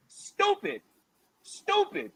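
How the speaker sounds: high, disgusted put-down; scornful. Real, not acted. Scornful repeated insult.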